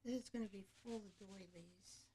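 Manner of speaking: normal talking voice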